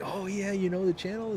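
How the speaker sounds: mock excited fan. Imagined chat with stranger.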